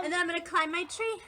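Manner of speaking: ordinary talk, nothing marked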